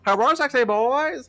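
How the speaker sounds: high loud mock greeting; hammed-up greeting. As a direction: squeal the greeting like a fan. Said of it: "That's how he said it".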